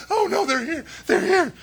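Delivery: high, loud frantic alarm with a laugh; hammed-up terrified whisper-shout turned yell; mock panic. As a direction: shriek it as the knocking starts. Disciples panic at the door knock.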